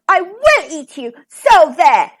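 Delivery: exasperated, roared threat; roaring. A narrator's reading. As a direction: roar the warning with hard stresses. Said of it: "he roared".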